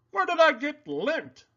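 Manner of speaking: a pig fretting over his dry skin; worried Poppleton. A narrator's reading. Pig calling his friend, high and dismayed. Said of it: "asked Poppleton".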